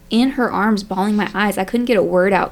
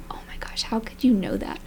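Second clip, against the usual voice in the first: whispered in awe, softer; stunned whisper; 2 semitones higher, 9 dB quieter than usual. Retold or imitated. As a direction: breathe it out, amazed she knew. Retelling stunned reaction at door.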